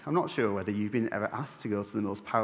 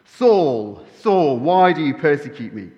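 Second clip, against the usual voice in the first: loud booming divine voice; 3 semitones higher, 11 dB louder than usual. Acted. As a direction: boom it down from heaven. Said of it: "a booming voice".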